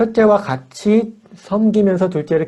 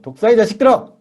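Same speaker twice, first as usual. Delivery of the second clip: louder, higher retold scolding; stern rebuke; 6 semitones higher, 5 dB louder than usual. Jesus rebuking hypocrites, retold as a louder, higher scolding cry. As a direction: thunder out the rebuke, mildly acted. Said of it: "예수님이 아주 호되게 꾸짖은 거".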